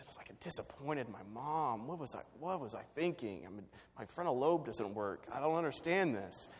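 soft, mock-anguished self-reproach; hammed-up quiet heartbreak; torn to pieces. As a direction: act out a kid crushed at letting mom down. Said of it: "like, torn to pieces".

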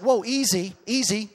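loud protest. Retold or imitated. Husband reacting to his wife's blunt remark, loud mock protest. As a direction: raise the pitch and fend them off, playfully indignant. Retold protest to teasing wife.

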